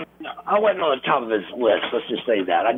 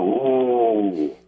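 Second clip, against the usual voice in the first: low, hushed crowd "Ooh"; an impressed audience murmur; imitated crowd "ooh"; 3 semitones lower than usual. Imitating the crowd's hushed "Ooh" at a clean break. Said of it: "they go".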